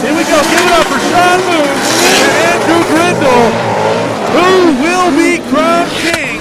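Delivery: big arena hype call; hype announcer. Acted. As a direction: sell it to the crowd. Announcer hypes the finalists.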